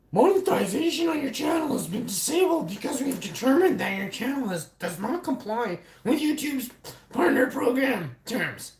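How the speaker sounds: high, mocking read of the notice. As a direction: read it in a whiny parody voice. Reading YouTube notice mockingly.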